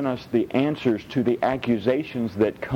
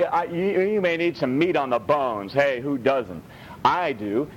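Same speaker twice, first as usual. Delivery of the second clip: normal talking voice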